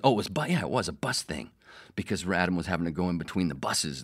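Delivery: normal talking voice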